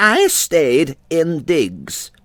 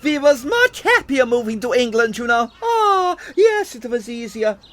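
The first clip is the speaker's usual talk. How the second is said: put-on chatty old-country theatrical landlady; 3 semitones higher, 3 dB quieter than usual. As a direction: reminisce proudly as an immigrant seaside landlady, voice leaping up on exclamations. Plays the immigrant landlady introduced beforehand.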